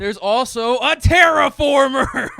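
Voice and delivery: high, very loud, drawn-out bellow; over-the-top epic announcement; shouted dramatic reveal. Real, not acted. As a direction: roar the last word slowly, half laughing. Shouts the climactic movie reveal.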